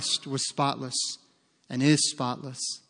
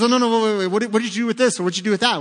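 much higher and louder flustered angel; startled angel; 6 semitones higher, 5 dB louder, much faster than usual. Retold or imitated. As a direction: angel stopping him in alarm. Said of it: "He goes".

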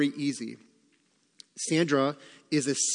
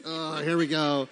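weary drudge. Retold or imitated. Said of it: "I kinda drudge in".

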